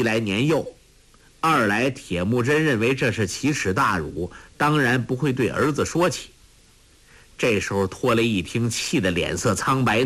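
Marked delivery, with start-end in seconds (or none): none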